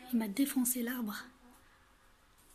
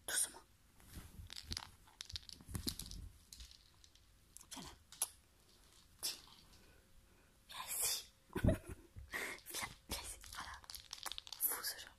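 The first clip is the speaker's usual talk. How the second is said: soft whispering to a sleepy cat; ASMR whisper; 13 dB quieter than usual. Real, not acted. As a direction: hush it, barely a breath. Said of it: "Je fais de la A S M R".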